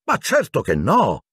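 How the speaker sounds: high-pitched offended exclamation; indignant anger. A narrator's reading. Matron denying the children left their beds, incensed. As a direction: snap back, voice swooping up. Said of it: "esclamò Madame Pomfrey incollerita".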